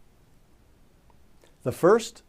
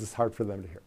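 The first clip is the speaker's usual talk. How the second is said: "it's hard for them to hear" fading, much softer and lower; trailing off; 6 semitones lower, 9 dB quieter, much faster than usual. Shown as a demonstration. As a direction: let "it's hard for them to hear" drop away to nearly nothing. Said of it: "Imagine how that sounds".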